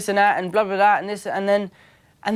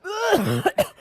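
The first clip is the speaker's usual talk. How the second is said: high, choked mock cough; imitated coughing fit; 14 semitones higher than usual. Actor lighting a strong cigarette on camera, acted choking. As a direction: splutter as if choking on smoke.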